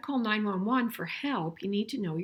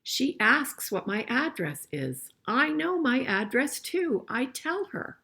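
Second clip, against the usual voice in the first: put-on reading a picture book aloud to kids, a bit louder; storybook reading voice; story reading; 4 semitones higher, 4 dB louder, slower than usual. A narrator's reading. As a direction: read it out clearly for the class.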